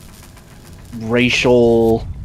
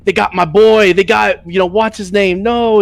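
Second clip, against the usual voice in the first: somewhat higher mock wail; lightly hammed grieving villager; a hint of over-the-top grief; mock distressed NPC; 6 semitones higher than usual. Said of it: "this guy who's like".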